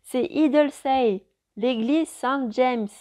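slow, loud, spelled-out shout. A narrator's reading. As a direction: shout each word slowly over noise. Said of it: "en parlant le plus fort possible".

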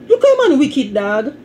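high-pitched teasing cry of disbelief; mock-shocked exclamation. Real, not acted.

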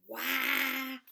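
high, loud siren wail "Wah!". A narrator's reading. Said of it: "wailed the sirens".

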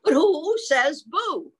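loud, high, swooping question; animated storyteller question; picture-book reading. Acted. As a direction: ask it with exaggerated wonder for a child. Reads picture book to child.

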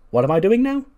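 high, loud, rising question; acted incredulous protest. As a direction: act put-upon, pitch climbing to the end. Voices Candice's reply when accused.

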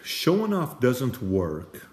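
ordinary delivery

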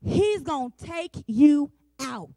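slow, loud warning cry; preaching shout. Real, not acted. Preacher warning mothers about the devil, loud and slow. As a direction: bellow the warning slowly.

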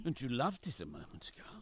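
hushed whisper in the wood. Acted. Father to his frightened daughter in the dark wood, whispering. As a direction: whisper it so the wood can't hear.

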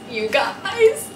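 high delighted squeal; excited squeal. Real, not acted. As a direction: squeal it like you can't contain yourself. Squealing at new Prada shoes.

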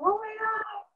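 high, breathless oh my God; amazed. Retold or imitated. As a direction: gasp it in disbelief. Hearing about distribution offer.

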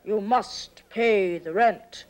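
low, slow, menacing villain; mustache twirling villain. Acted. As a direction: growl it like a melodrama villain. Play with villain and damsel.

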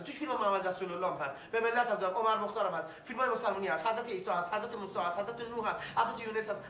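ordinary delivery

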